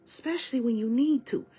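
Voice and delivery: ordinary delivery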